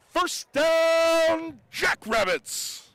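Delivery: huge high shout, drawn-out finish; fighting game announcer. Pretend. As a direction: boom it out like an arena announcer. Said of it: "It's catchphrase time".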